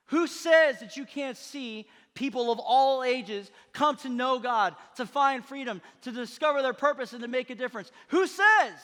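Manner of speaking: high, loud preaching shout; rally them at full force, phrase by phrase; rousing challenge. Real, not acted. Preacher challenging the church over doubters, rousing shout.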